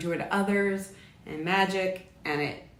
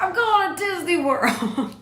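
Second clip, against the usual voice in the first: much higher, louder, slow triumphant cry; Super Bowl winner; 12 semitones higher, 6 dB louder, slower than usual. Retold or imitated. Voicing her mom answering like a Super Bowl winner, much higher, louder and slow. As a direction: shout it like the champion on TV. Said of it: "just like in the Super Bowl".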